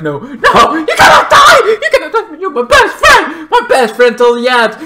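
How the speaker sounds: high, loud screaming with half-laughing sobs; hammed-up anguished yelling, slowing at the end; grief-stricken shout. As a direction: scream it over your dying best friend. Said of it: "this is when he snaps".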